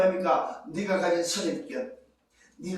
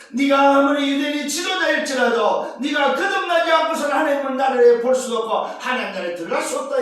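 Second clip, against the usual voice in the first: forceful preaching; 5 semitones higher, 8 dB louder than usual. Acted.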